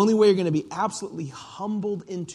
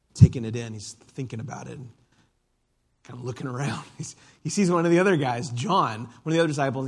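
usual speaking voice

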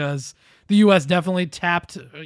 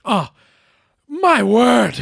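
higher, louder hammed-up British accent; mock British; 6 semitones higher, 8 dB louder, much slower than usual. Shown as a demonstration. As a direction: exclaim it like a scandalized British aunt. Answering with a British exclamation.

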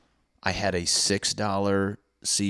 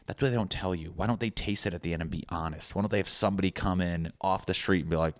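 ordinary talk

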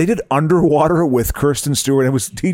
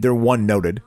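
normal talking voice

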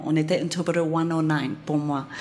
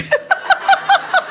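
high laugh; 6 dB louder than usual. Real, not acted. Skipped jet for law class.

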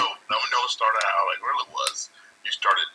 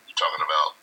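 ordinary talk, nothing marked

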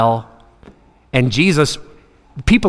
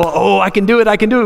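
put-on higher-pitched eager child boast; excited kid; 5 semitones higher, faster than usual. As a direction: imitate a child bursting to have a go. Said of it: "your kids get all excited about something".

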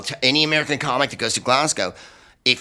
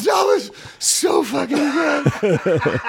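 slow, much higher, breaking into laughter; laughing through it; much slower than usual. Retold or imitated. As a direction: cackle the praise out. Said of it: "he goes".

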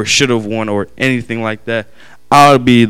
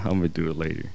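a low, offhand mumble, softer; brushing off softly; 5 semitones lower, 11 dB quieter, faster than usual. Retold or imitated. Putting off his little sister, softer and offhand. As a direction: shrug it off under your breath.

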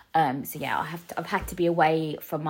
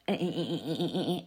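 buzzer noise; 3 semitones lower, 5 dB quieter, much faster than usual. Real, not acted. Mock buzzer refusal noise.

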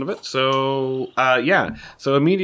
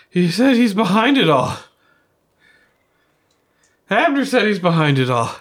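high, loud insistent telling; urgent accusation; 9 semitones higher, 7 dB louder than usual. Acted. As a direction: press the accusation hard. Belvedere accuses Abner urgently.

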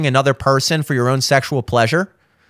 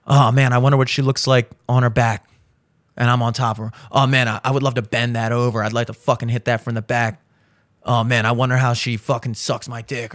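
imagined guy's thoughts; 8 semitones lower, 4 dB quieter, faster than usual. Retold or imitated. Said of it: "He's imagining".

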